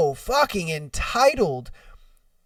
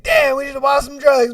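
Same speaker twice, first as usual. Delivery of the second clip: higher-pitched comic lament, less shouted; mock regret; 6 semitones higher, 3 dB louder than usual. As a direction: repeat the punchline high, short of a yell. Repeats own punchline, laughing.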